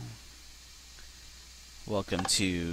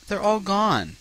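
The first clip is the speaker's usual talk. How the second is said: disbelief; 11 semitones higher, 4 dB louder than usual. Real, not acted. Carts vanished.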